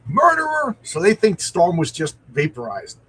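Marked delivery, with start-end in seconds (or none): 0.1-0.7 much higher and louder, a full mock outcry; shrieked accusation, high and loud; accusing scream. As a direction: hurl the word at the killer. Comic character screams at Archon.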